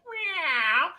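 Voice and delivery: high, soft rising meow; cat meow. Retold or imitated. Imitating his daughter playing cat, high meow. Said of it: "she's like".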